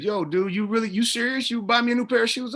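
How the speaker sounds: high-pitched mock astonishment; imitated disbelief. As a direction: ask as the stunned stranger who can't believe the offer. Rapper asks in disbelief, retold.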